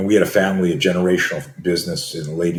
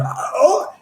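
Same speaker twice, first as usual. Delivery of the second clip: high breathless gasp; faked gasping cry. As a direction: gasp like the wind's knocked out. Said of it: "I faked being out of breath".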